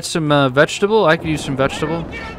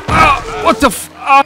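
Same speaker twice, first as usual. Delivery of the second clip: high-pitched startled cursing; startled yelp; 10 semitones higher, 3 dB louder than usual. Real, not acted. Gamer caught off guard mid-game, startled. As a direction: yelp and swear in sudden shock.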